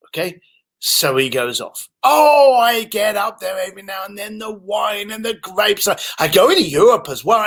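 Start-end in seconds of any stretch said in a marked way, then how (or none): 2.0-7.4 very excited. Retold or imitated. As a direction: burst into it and ramble on happily. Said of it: "Very excited".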